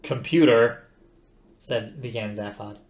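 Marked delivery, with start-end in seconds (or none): 0.0-0.9 louder, commanding call; loud warning. A narrator's reading. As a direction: call it out to get attention. Zaphod warns the computer.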